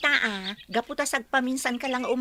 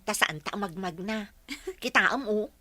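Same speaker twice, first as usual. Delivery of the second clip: ordinary talk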